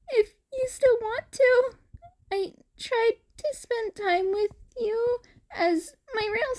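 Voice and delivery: put-on high boy voice, close to crying in places; teary cat boy. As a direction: plead for friendship, voice wobbling.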